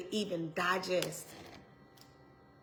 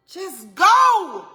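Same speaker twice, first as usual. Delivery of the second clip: sharp loud higher call; loud command; 8 semitones higher, 12 dB louder than usual. Acted. Preacher urges the stalled listener to move, loud. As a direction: push the words out like an order. Repeating God's command loudly.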